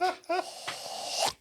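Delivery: long throaty alien exhale; xenomorph hiss. Shown as a demonstration. Guest showing the throat sound through Aliens, xenomorph hiss. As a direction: imitate a movie alien hissing from the throat. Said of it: "xenomorph, like, sticks its mouth and goes".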